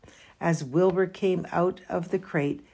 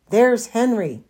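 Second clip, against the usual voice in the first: higher, louder eager call; excited; 6 semitones higher, 7 dB louder than usual. A narrator's reading. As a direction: spot a friend in the crowd. Fern excitedly spotting Henry.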